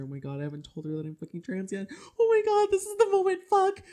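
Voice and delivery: high, loud panicked squeal; rapid panic rising into a shrill-pitched outburst; acted-out panic. Storyteller replaying shock at spotting their sister, panicked squeal. Acts out own panicked inner reaction.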